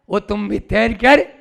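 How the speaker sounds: normal talking voice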